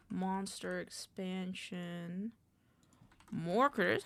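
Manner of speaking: ordinary talk, nothing marked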